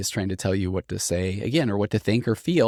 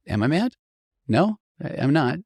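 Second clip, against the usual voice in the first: puzzled self-question; 7 semitones higher than usual. Retold or imitated. Inner questioning quote.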